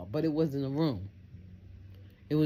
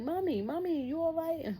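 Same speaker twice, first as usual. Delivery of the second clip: much higher pleading call; worried neighbor. Retold or imitated. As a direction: call Mommy high and anxious. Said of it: "They was like".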